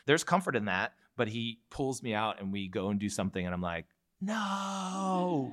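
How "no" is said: long, high-pitched wail of refusal; mock protest. Partner pulls you out to do something, mock protest. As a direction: whine it out in playful dread. Acts out resisting being pulled out.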